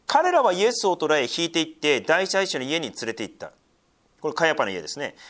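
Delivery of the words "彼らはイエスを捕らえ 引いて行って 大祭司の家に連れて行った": raised, declaiming Bible reading; scripture reading. Acted. Preacher reading the Gospel to his church, raised and declaiming. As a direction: proclaim the passage to the congregation.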